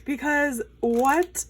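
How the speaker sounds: high, drawn-out incredulous question; confused disbelief. Real, not acted. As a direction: stretch it out, voice rising in disbelief. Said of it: "I'm confused".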